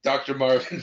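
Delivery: ordinary talk, nothing marked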